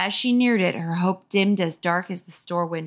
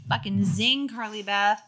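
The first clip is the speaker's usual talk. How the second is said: ordinary talk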